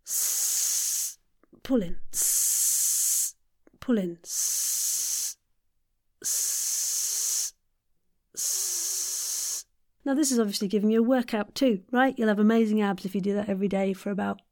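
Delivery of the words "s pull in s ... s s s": s- hissed in pulses, pulling in the tummy between; pulsed S hiss. Shown as a demonstration. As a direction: hiss s- in bursts, tighten the tummy. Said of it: "relax as you continue with the S".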